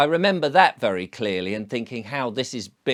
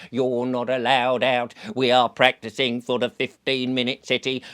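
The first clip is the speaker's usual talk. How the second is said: robotic drone voice; 2 semitones lower than usual. Retold or imitated. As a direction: sound like a surveillance drone barking orders. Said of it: "a drone is gonna swoop down and say".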